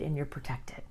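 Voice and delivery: soft whispered affirmation. Shown as a demonstration. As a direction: breathe it like a secret. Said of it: "And say".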